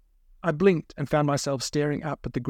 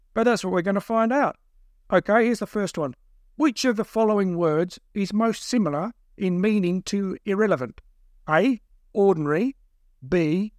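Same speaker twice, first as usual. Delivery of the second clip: higher, deliberate quiz reading; pitched-up examiner, each option set apart; quizmaster kidnapper; 6 semitones higher than usual. A narrator's reading. Second kidnapper testing the captive, relishing it.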